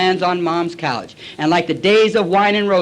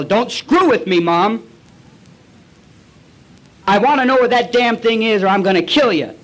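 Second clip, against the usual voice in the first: loud, higher-pitched demand; raised, menacing voice; angry threat; 4 semitones higher, 4 dB louder than usual. Acted. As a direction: threaten her with full drunken force. Acts out threatening his mother.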